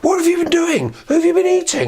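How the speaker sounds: hammed-up shocked father; father confronting wolf. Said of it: "the father said".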